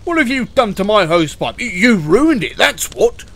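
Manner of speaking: irate fire engine telling someone off; screeching. Acted. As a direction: scold at full volume, spluttering with outrage. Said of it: "screeched the fire engine".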